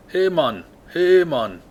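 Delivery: high, punchy mock Jamaican; attempted Jamaican accent. Shown as a demonstration. Player practising the accent, mock Jamaican. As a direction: call out a badly faked Jamaican greeting. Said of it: "It's supposed to be Jamaica".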